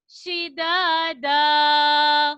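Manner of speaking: slow melodic tilawat with drawn-out vowels; Quran recitation. Shown as a demonstration. Teacher reciting the verse to the children, slow melodic chant. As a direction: chant the verse slowly like a qari.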